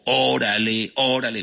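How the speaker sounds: "Órale" with the first syllable punched; stress on the O. Shown as a demonstration. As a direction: show where the accent falls in "órale", on the initial O. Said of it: "¿Ves que ahí va el acento?". ("órale" con acento marcado en la O inicial)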